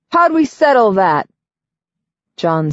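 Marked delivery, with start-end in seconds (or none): 0.1-1.3 smug teasing question; cocky young man. A narrator's reading. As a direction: needle him with a grin. Nine testing John, cocky.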